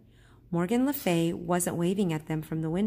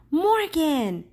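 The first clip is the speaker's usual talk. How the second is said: high, loud glad call of her name; excited cry; 10 semitones higher, 7 dB louder than usual. Acted. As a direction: call out in joyful surprise. Annie cries out, seeing Morgan.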